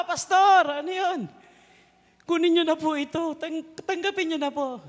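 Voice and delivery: high and loud, hurrying to catch up; wife running after the pastor, urgently pleading; urgent plea. Retold or imitated. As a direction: beg him to take the gift, breathless. Woman runs after, pleading.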